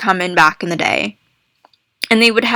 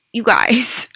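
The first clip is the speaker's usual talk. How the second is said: whispering. Real, not acted. Whispered awe at the box.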